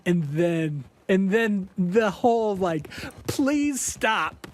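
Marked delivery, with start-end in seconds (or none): 3.3-4.5 high, slow whiny plea; mock begging her to stop; pleading bandmate.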